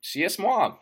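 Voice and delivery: tongue-in-cheek literary grandeur; mock pretentious. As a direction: proclaim it like a pompous novelist. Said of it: "I'm sounding fucking pretentious".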